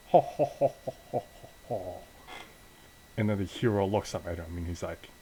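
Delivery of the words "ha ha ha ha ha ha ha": stagy evil laugh, starting high; mock "ha ha ha" fading into breath; villain's laugh. Bad guy mocking the hero's last rites, evil laugh. Villain mocks the praying hero.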